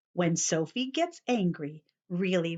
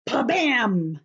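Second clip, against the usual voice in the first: door slam; 3 semitones higher, 5 dB louder than usual. Acted.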